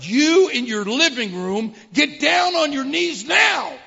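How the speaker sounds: loud, high preacher's shout; booming command. Retold or imitated. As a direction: command the whole room to kneel. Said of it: "This is what we heard".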